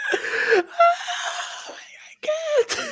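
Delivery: real helpless high squeal of laughter; squeaking words out through laughter; out-of-breath laughing. Real, not acted. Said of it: "I was laughing out of breath".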